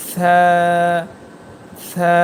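সা as a long, thin "thaa"; tongue tip at upper teeth edges for a lisped, held সা; thin letter sound. Shown as a demonstration. Teacher showing the thin letter, long lisped সা. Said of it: "এই হরফটি পাতলা".

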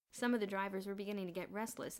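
plain reading voice

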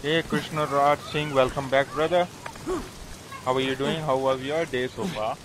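normal talking voice

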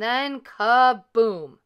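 slow, loud kaboom. A narrator's reading. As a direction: boom it out slowly. Chair breaking in story.

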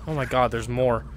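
normal talking voice

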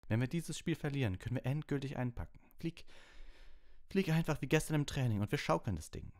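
high, pressing team talk; lightly voiced eager captain; urgent captain Wood. A narrator's reading. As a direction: fire up the seeker before the match. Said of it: "sagte Wood".

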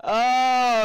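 loud dismayed oh. Real, not acted. Upset exclamation.

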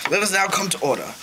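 usual speaking voice

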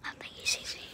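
unvoiced hiding whisper. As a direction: whisper so the zombie won't hear.

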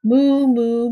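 loud mooing cow call; loud imitated moo. A narrator's reading. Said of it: "says the cow".

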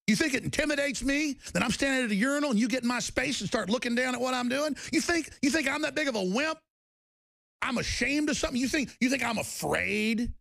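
worked-up defiance; defiant challenge. Real, not acted. Defiant challenge to absent foes.

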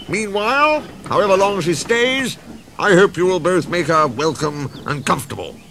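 lightly voiced railway boss, high start easing down; polite but firm instruction; Fat Controller, authoritative. A narrator's reading. Fat Controller asks engines to welcome Daisy, mildly pompous.

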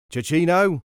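loud, high searching call. A narrator's reading. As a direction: call the boy's name to find him. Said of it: "they called".

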